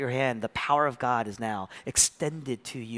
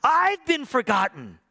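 slow, high, drawn-out cry; loud lament from the cross; 11 semitones higher, 7 dB louder, slower than usual. Acted. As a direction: stretch each word into an anguished cry. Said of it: "And when he cried".

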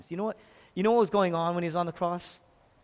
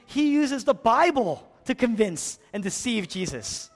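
loud, higher, driving sermon delivery; preaching at full force; 7 semitones higher, 6 dB louder than usual. Acted. Pastor stirs the congregation over Satan's temptations, preaching loud. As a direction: preach it out with rising fire.

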